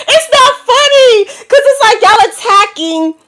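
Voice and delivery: real laughter while warning viewers; laughing hard. Real, not acted. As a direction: squeal through a helpless laugh. Laughs at her own warning.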